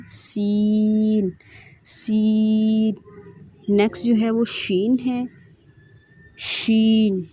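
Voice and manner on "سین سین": سین drawn out with a long whistling hiss; slow, sibilant سین. Shown as a demonstration. Teacher drawing out سین to show its whistle. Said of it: "سین میں سیٹی کی آواز آئے گی".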